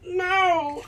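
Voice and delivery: high shrieked no; hammed-up scream at an eel; mock panic no. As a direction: cry out in play terror.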